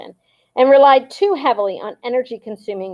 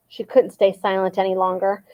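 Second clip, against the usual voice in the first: ordinary delivery, nothing marked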